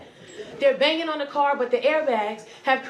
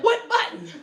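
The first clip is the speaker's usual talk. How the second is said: high-pitched, baffled question; hammed-up bewilderment; baffled exasperation; 9 semitones higher than usual. Trapped driver can't find the button, baffled exasperation.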